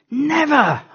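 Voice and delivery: high, loud shout of "Never!". Shown as a demonstration. Preacher showing how Paul would shout, loud and high. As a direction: yell it out at the top of your voice. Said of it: "at the top of his voice".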